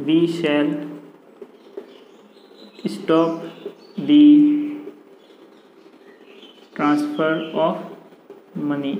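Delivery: long pauses between single words; word-by-word dictation. Real, not acted. Teacher writing out the passive sentence for students, word-by-word dictation. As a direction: dictate it slowly, one word at a time.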